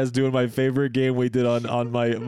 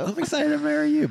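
very high-pitched sugary echo; mock gushing. Partner echoes wedding excitement, mock high gushing. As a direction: squeak it in an exaggerated high voice.

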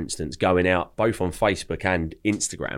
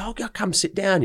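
much higher-pitched host voice; imitating welcoming owner; 12 semitones higher than usual. Owner seats the chef's best friend, mock-eager welcome. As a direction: usher them in with a high, put-on voice. Acts out owner seating guests.

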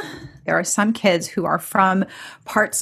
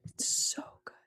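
whispered delight; 16 dB quieter than usual. Real, not acted.

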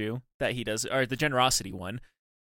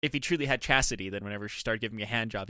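ordinary delivery

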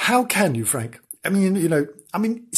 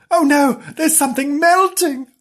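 alarmed; 12 semitones higher, 4 dB louder than usual. Acted. Character finds melting spot.

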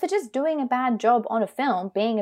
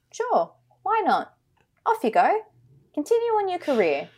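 higher-pitched, put-on casual permission; breezy mock approval; 8 semitones higher than usual. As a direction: wave them on with airy, mocking generosity. Mimicking breezy, forgiving film industry.

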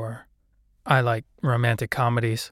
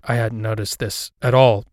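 usual speaking voice

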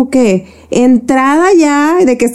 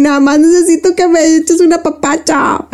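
higher, pleading mock-tearful voice; near tears; 7 semitones higher than usual. Wife retelling how she begged her husband for comfort, mock near tears. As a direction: plead like you're about to cry, lightly. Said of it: "al borde del llanto".

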